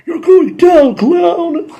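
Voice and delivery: high, loud hammed-up hero taunt; over-the-top kids' show hero voice; Red Ranger line. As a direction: ham up a cheesy hero's taunt. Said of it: "the Red Ranger says this".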